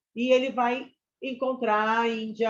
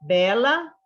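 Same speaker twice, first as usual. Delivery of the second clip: "Bela" said louder with a rising tune; emphasized example word; 2 semitones higher, 7 dB louder than usual. Shown as a demonstration. Teacher highlights the rhyme example for students. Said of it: "a gente tem outra rima rica".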